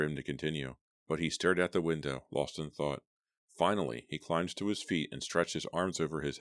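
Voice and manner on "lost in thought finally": ordinary delivery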